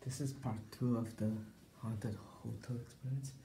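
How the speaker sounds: hushed, nervous vlog voice; nervous night vlog whisper. Acted. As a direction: keep it hushed, like ghosts might hear. Hushed nervous vlog after scare.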